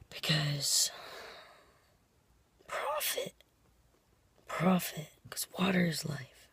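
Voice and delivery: hoarse whisper from a lost voice. Real, not acted. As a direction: whisper through a sore, lost voice. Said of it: "lost my voice at work".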